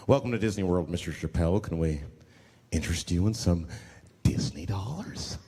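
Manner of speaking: deep customer-service voice; polite hotel clerk. Retold or imitated. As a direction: greet a guest at the desk.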